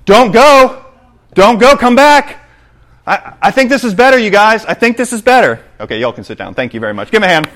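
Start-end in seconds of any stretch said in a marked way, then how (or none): 0.0-2.5 high, loud pleading shout; yelling. Acted. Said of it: "they're yelling". 3.1-5.6 high, raised insisting voice; high-pitched stubborn insisting. Acted. Wanderer insisting on his choice.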